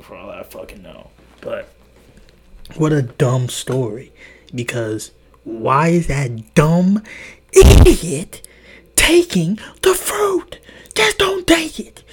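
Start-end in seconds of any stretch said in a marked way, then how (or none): 4.5-12.0 higher, louder indignant outburst; exasperated rant. Real, not acted. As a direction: vent your outrage at a dumb choice. Ranting about Eve taking fruit.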